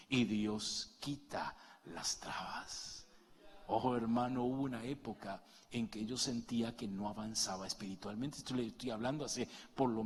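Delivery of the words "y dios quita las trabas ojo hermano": usual speaking voice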